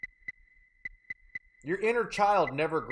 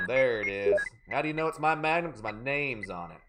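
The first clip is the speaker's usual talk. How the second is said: ordinary talk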